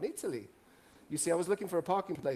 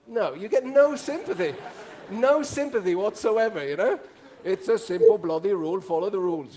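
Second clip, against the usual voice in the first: mock stern; 3 semitones higher, 8 dB louder than usual. Mock-stern telling off audience.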